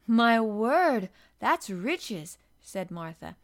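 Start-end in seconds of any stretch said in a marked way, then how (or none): 0.1-2.4 loud amazed exclamation. A narrator's reading. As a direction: marvel out loud at the riches. Martha amazed at money.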